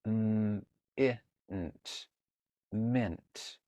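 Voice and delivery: segmenting "mint" into M, I, nt, then blending; sounding out mint. Shown as a demonstration. Teacher sounding out a highlighted word while reading. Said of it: "It's got the nt".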